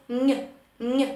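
«н» pressed with the back of the tongue to the palate, nasal; Spanish ñ sound. Shown as a demonstration. Teacher demonstrates the nasal Spanish ñ for students. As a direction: hum «н» through the nose like a blocked nose. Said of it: "І буде звук".

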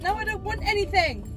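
imitated fed-up refusal; retold exasperated refusal. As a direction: refuse firmly, pitch and volume up. Retold final refusal to sister.

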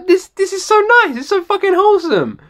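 high, touched gushing; delighted and a bit teary; moved delight. Real, not acted. Touched by the song, high moved gushing. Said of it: "I am a little bit teary".

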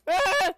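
high, loud "ah!"; sudden shriek of alarm; startled scream. Real, not acted. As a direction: scream as if jumped. Startled by zombies in game.

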